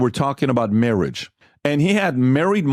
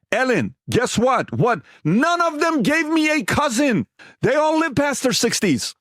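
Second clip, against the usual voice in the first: much higher, exasperated protest; worked-up, high-pitched reenacted rant; retold argument; 10 semitones higher than usual. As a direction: argue your case to dad, incredulous. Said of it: "One of the biggest fights".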